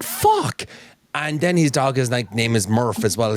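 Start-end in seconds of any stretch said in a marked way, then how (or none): 0.0-0.6 exasperated squeak. Retold or imitated. Exasperated reaction retold.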